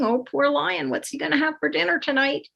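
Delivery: lightly acted pitying thought; mock sympathy.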